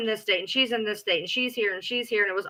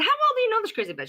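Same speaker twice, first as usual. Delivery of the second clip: high incredulous question; 12 semitones higher, faster than usual. Retold or imitated. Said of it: "I said".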